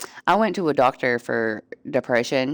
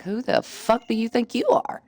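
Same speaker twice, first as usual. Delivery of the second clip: a touch of retold rage, raised pitch; imitating her past snap at Junior, higher; mock fury; 6 semitones higher than usual. Retelling snapping at a helpful friend, mock furious. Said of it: "I was so mad".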